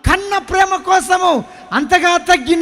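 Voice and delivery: loud, slow, high proclamation; God's answer proclaimed. Retold or imitated. Preacher repeats what God told him: slow loud proclamation. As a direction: proclaim it slowly as a voice from heaven. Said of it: "ఇట్నే వినిపించిండు సరందారు నాకు".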